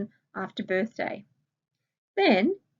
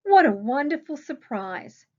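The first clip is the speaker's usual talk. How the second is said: delighted; 5 semitones higher, 6 dB louder than usual. Acted. Reading Kate's delighted line.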